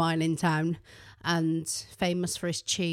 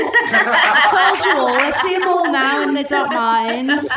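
loud, high, laughing told-you-so; gloating laugh; 7 semitones higher, 12 dB louder than usual. Retold or imitated. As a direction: crow it through laughter. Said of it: "And I was like".

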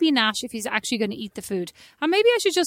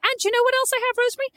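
much higher, eager showing-off; excited boast; 12 semitones higher, much faster than usual. Real, not acted. Sister boasting eagerly about her decor, much higher. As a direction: brag like you can't wait.

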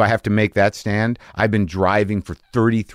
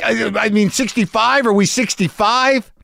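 much higher hammed-up indignant question; mock angry correction; 13 semitones higher than usual. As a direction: correct yourself mid-fight, mock shrill. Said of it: "And I'm like".

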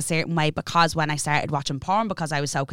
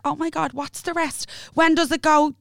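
high worried voice; fretful drugged; 9 semitones higher than usual. Retold or imitated. [name] imitating herself panicking about Yankee Doodle, high. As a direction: fret over the song lyrics. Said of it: "I'm like".

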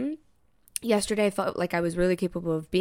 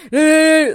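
loud, high mocking "ehhh"; exaggerated whiny outburst noise; mock crazy noise; 7 semitones higher, 11 dB louder than usual. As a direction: imitate someone acting all crazy. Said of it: "acting all crazy".

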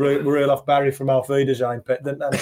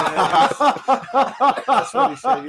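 laughing; 10 semitones higher, much slower than usual. Real, not acted. Laughing at dad's job joke.

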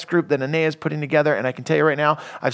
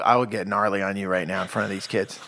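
ordinary delivery

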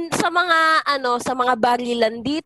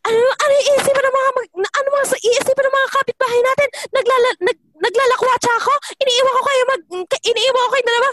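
higher, louder, rapid scolding; scolding mother; 10 semitones higher, 3 dB louder, faster than usual. Retold or imitated. Mother scolds her child in front of the neighbors, angry rant. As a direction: scold like an embarrassed mom. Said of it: "Sabi niya".

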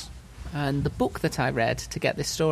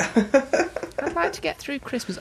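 put-on old lady voice; 11 semitones higher, slower than usual. Old patient answers the killer.

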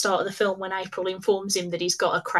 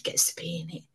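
whisper; 5 semitones lower, 10 dB quieter than usual. Real, not acted. Jealous aside.

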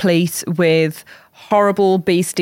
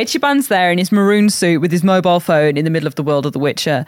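normal talking voice

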